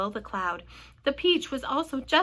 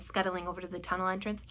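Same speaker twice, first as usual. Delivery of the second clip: ordinary delivery, nothing marked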